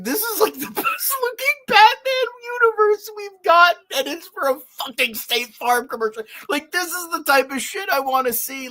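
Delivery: high, loud, laughing gush; laughing delight. Real, not acted. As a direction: squeal with delight about the Batman universe. Gushing over the commercial.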